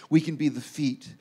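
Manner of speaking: normal talking voice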